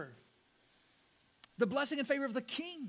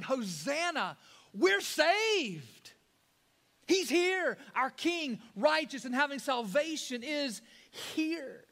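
higher, louder triumphant announcement; joyful declaration; 5 semitones higher, 4 dB louder, slower than usual. Retold or imitated. Preacher voicing the crowd declaring joyfully. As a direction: proclaim it with joy. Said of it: "They're declaring".

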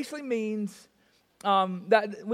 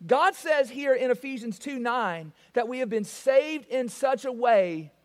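ordinary delivery

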